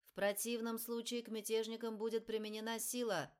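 loud metallic megaphone voice; booming metallic loudspeaker. Acted. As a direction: blare it through a loudspeaker. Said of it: "раздался металлический голос громкоговорителей". (металлический голос громкоговорителя)

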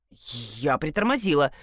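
plain reading voice

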